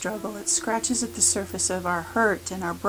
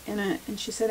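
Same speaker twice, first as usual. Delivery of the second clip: ordinary talk, nothing marked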